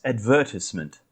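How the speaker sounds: "advertisement" stressed on the second syllable, ad-VER-tis-ment; British stress on VER. Shown as a demonstration. Host shows co-host the British way, "advertisement" stressed on VER. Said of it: "the British pronunciation of advertisement".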